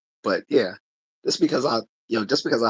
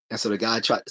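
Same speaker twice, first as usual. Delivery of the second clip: normal talking voice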